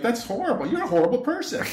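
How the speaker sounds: high-pitched retold indignation; outraged scolding. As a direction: replay a scandalized telling-off, pitched well up. Retells outraged reply in argument.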